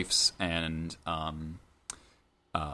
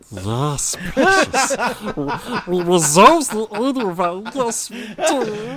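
high, raspy creature voice with a laugh; Gollum voice; 13 dB louder, much slower than usual. Acted. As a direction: hiss it like Gollum, giggling. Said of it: "start talking as Gollum".

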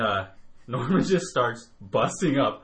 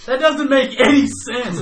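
raised, high-pitched retold protest; exasperated objection; 10 semitones higher, 8 dB louder than usual. Arguing with Norman on the drive, exasperated. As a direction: drag it out loudly in disbelief. Retelling own objection to friend.

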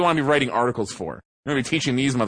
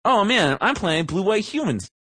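lightly voiced, higher-pitched casual player; eager player imitation; 6 semitones higher than usual. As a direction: imitate an earnest newcomer announcing a deck.

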